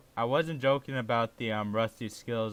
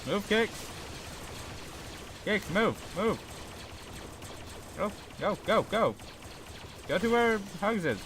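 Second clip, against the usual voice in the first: urgent shouting; 7 semitones higher than usual. Real, not acted. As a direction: hurry him along. Urging teammate to move.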